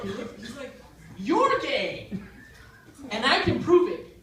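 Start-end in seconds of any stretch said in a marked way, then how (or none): 1.2-4.2 high, loud accusing outburst; acted loud accusation. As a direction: fling it out as a challenge, loud and high.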